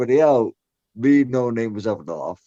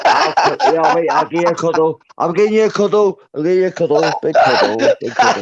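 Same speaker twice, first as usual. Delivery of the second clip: higher and louder, laughing through the offer; laughing cuddle offer; 6 semitones higher, 7 dB louder than usual. Real, not acted. Drunk mate teasing Demon with a loud laughing cuddle offer. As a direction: tease him with a big laughing cuddle.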